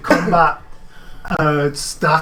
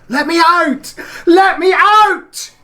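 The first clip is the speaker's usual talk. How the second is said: shrill desperate scream, much higher and louder; 12 dB louder, slower than usual. Acted. Terrified woman screams at the locked door. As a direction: shriek to be let out, panicked. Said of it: "screaming again".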